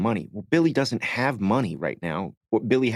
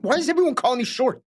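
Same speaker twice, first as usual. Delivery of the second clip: much higher, exasperated cry; indignant outburst; faster than usual. Retold or imitated. Actor replays his unscripted protest, high and exasperated. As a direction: burst out in mock indignation at being called short. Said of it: "because I'm like".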